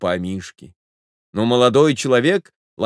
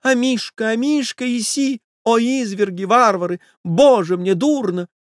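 high, hysterical mistress; distraught high wailing over a pet; lady crying out; 13 semitones higher than usual. A narrator's reading. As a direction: lament in a high-pitched lady's voice. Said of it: "закричала дама".

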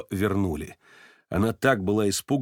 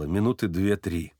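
ordinary delivery, nothing marked

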